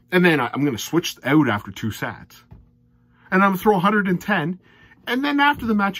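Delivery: loud, high, exasperated rant at the player; fed-up darts fan ranting loudly. Real, not acted. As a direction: vent in disbelief, almost yelling. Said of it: "Sorry for my rants".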